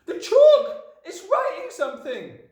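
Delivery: shrieked, very high alarm; screaming Nigel. A narrator's reading. Nigel warning the class of the chalk, high scream. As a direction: cry out as if seeing the impossible. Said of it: "screamed Nigel".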